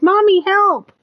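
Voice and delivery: high squeaky kitten plea; cat voice. Retold or imitated. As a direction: voice the cat crying for help. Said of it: "He can do a specific mew".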